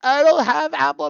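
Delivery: high, loud outburst; excited exclaim. Real, not acted. Streamer protesting to chat, high and loud. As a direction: blurt it out to chat.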